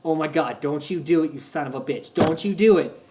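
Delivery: tense excitement. Retold or imitated. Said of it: "I'm like".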